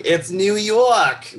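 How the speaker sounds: put-on loud, high, drawn-out New York accent; hammed-up New York accent. Mocking how a show introduces its city, New York accent. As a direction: stretch it out slowly in big New York tones.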